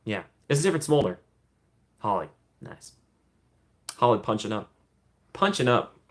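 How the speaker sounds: ordinary talk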